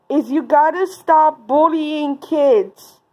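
ordinary talk, nothing marked

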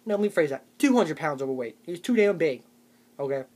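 usual speaking voice

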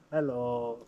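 usual speaking voice

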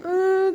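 high fake baby whimper; mock whimper. Shown as a demonstration. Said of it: "diye mi ağlıyorsun".

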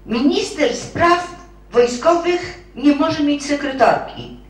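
high, loud and slow, word by word; triumphant final argument. Acted. Clerk hits the marshal with her last argument, high, loud and slow. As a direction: play the trump card, loud and deliberate.

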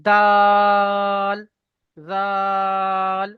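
দাল, জাল with the vowel held three alif counts; long drawn vowel. Shown as a demonstration. Teacher drawing out দাল and জাল for students. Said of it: "এরকম তিন আলিফ পরিমাণ টান দিয়ে পড়বেন".

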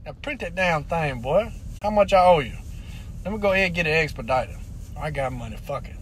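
acted low, grudging mumble; subdued backing down. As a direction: drop right down and grumble it under your breath. Backing down in the passport scene.

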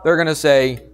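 ordinary delivery

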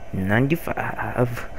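NINETY-FIVE soft and slow, FIVE stretched; drawn-out punch line. Pretend. Player answering own joke, soft drawn-out "Ninety-five". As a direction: draw out FIVE softly in "Ninety-five".

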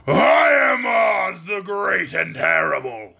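monstrous roar, slow and heavy; roaring beast. Acted. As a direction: boom it out like a monster on a throne. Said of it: "in a voice that was one great roar".

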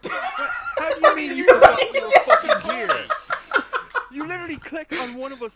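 shrieking disbelief, laughing; high laughing outrage. Real, not acted. Mock outrage at teammate.

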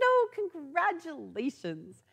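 telling the angry customer congratulations in a syrupy tone; mock cheerful. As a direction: play it falsely bright to an angry caller.

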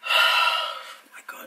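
slow breathless whisper. Real, not acted. Staring at her haircut, whispering in shock.

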